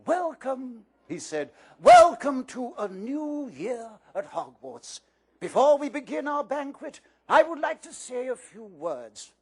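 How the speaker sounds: beam at the students; Dumbledore welcoming. A narrator's reading. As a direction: headmaster greeting the school. Reads Dumbledore's speech.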